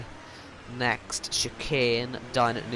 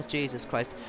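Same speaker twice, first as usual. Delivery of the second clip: normal talking voice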